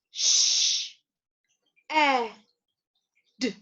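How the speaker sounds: "Sh-, e-, d" as three separate phonemes with long pauses; sounded out. Shown as a demonstration. Teacher leading learners through the word's phonemes, sounded out slowly. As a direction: break "shed" slowly apart, sound by sound. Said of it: "let's try it one more time".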